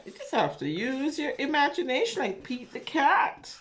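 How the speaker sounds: ordinary delivery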